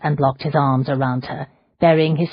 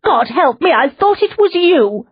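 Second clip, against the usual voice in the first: very high, louder shout of relief and fear; frantic shout; 13 semitones higher, 6 dB louder than usual. A narrator's reading. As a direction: cry it out, terrified it was him. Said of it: "she shouted".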